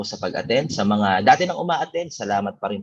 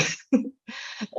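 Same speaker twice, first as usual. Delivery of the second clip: breathy high laugh; 12 semitones higher, 6 dB quieter than usual. Real, not acted. Laughs recalling the story.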